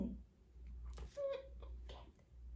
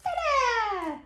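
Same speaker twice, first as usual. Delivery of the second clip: high Dada sliding down; playful drawn-out high cheer; exclaimed refrain; 7 semitones higher than usual. Acted. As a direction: sing it out high for baby. Parent cheers book's refrain to child.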